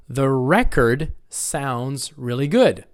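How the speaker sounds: slow line, record as REK-erd; slow model with record stressed up front; first-syllable stress. Shown as a demonstration. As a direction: stress REK in record, taking your time. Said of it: "the noun is on the first syllable".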